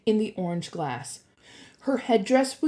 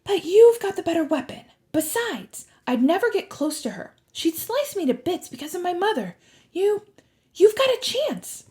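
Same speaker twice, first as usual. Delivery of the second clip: put-on high-pitched girl, reluctant then urging; high, earnest young voice; difficult admission; 10 semitones higher, 3 dB louder than usual. A narrator's reading. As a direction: admit it, then push them on. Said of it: "as if she were about to make a difficult admission".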